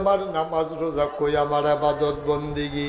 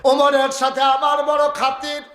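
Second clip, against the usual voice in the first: high, raised preacher's cry; full-force preaching; 10 semitones higher, 5 dB louder than usual. Acted. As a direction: belt it out to the crowd. Same words repeated at full force.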